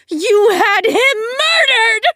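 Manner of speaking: high, loud crying scream, slowed. Acted. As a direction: scream the accusation through tears. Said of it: "she screamed".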